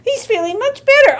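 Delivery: high-pitched happy exclamation; delighted relief. A narrator's reading. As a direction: announce the good news joyfully. Dog finally barks properly.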